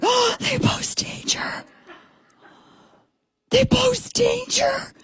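very high, slow, breathy whisper of awe; thrilled breathless whisper. Retold or imitated. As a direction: gasp it out like a secret dream come true. Breathless thrilled reaction to Trump line.

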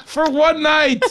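high, slow, swooning camp lilt; campy for one night. Pretend. Host camping it up about his dinner, high and drawn out. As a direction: ham it up like a starstruck date. Repeats camp line laughing.

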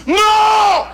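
sudden high, loud "No!"; a heckler cutting the joke off cold; angry heckler's shout. Retold or imitated. Ukrainian heckler to the comic, a high shouted no. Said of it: "and he goes".

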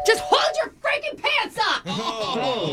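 yelling off mic. Acted. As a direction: shout across the room. Yelling at someone offstage.

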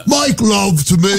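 high, drawn-out mock Cockney; slow, badly faked Cockney come-on; awful Cockney. Co-host spoofing the accent patient, hammed-up Cockney. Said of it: "You got the awful Cockney".

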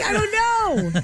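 high-pitched mock uncertainty; exaggerated doubt. Exaggerated doubt, quoting her own reaction.

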